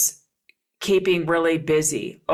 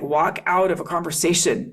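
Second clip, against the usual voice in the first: usual speaking voice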